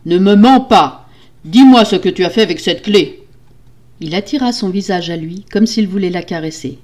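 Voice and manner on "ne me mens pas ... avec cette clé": loud, menacing order; angry demand. Acted. Husband interrogating his lying wife, angry demand. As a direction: demand the truth forcefully.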